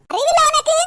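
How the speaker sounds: high, loud burst of exasperation; angry scolding. Acted. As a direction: yell it as a sharp rebuke. Mother berates daughter for foolishness. (கோபமாகக் கத்தித் திட்டுவது)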